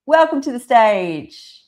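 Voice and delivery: ordinary talk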